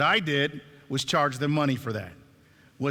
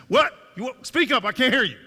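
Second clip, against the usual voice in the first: high mock yell, building louder; retold impatient yell; 9 semitones higher, 6 dB louder than usual. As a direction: imitate a sergeant yelling across the desk. Acted sergeant snapping at the mother.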